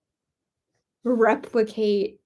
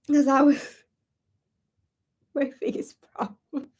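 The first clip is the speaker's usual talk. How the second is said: real laughter swallowing the words; cracking up; 3 semitones higher than usual. Real, not acted. Performer admitting laughing was the biggest problem, cracking up. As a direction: try not to crack up, voice giving way to laughter. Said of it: "trying not to crack up".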